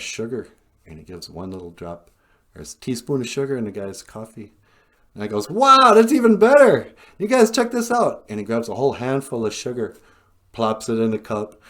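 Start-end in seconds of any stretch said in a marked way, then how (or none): 5.5-8.2 very high, loud outburst of delight; over-the-top amazed voice; thrilled exclaiming. Retold or imitated. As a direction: shout to friends about an amazing discovery. Acted taster thrilled by sugar.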